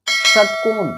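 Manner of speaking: षटकोण with a curled-back retroflex ष. Shown as a demonstration. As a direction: curl the tongue back on ष in षटकोण. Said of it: "षटकोण ना कि षटकोण".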